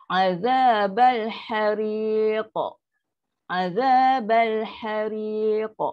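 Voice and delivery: slow recited Azabal hariq with a bouncing qalqalah on hariq; drawn-out tajwid recitation of Azabal hariq; Quranic recitation. Shown as a demonstration. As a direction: chant Azabal hariq slowly, the final q echoing. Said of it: "Macam ni nak berhenti dia".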